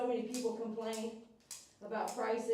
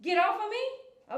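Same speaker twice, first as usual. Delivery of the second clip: high, louder, drawn-out mock protest; voicing the dog; 10 semitones higher, 6 dB louder than usual. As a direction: play the protesting dog, pitched up and stretched out. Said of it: "You say".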